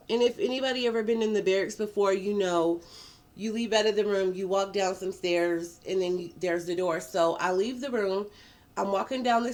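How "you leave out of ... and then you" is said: ordinary talk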